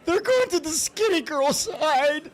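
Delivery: high, slow mock wail; teasing imitation of his wife crying. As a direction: imitate her sobbing these words. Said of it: "she starts crying again, going".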